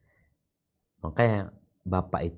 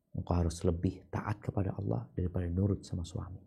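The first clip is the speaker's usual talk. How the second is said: whisper; 8 dB quieter than usual. Real, not acted. Soft urgent advice.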